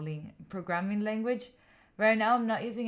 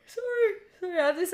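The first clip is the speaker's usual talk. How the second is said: high sobbing apology through tears; tired crying; 15 dB louder, faster than usual. Real, not acted. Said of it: "crying also".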